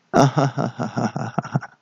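soft staged villain cackle; villain's laugh. Acted. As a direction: rattle off a mock-evil laugh, fairly quietly. Villain laughs after his threat.

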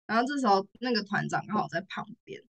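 ordinary delivery

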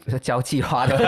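ordinary talk

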